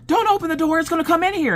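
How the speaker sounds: high, loud mock-frantic plea; hammed-up alarmed cry; retold panic. Acted panic, repeating her plea.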